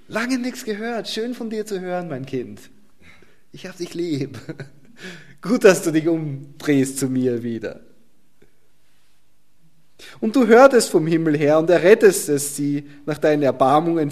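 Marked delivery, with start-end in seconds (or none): 0.0-7.8 put-on beam at the returning child and chuckle; voice of God welcoming. God gladly greeting his returning child, high and tender. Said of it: "Und Gott sagt".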